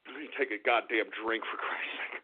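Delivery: low, soft grumble fading to breath; under-the-breath cursing; muttered aside. Real, not acted. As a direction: grumble while going for a drink. Steps aside for a drink.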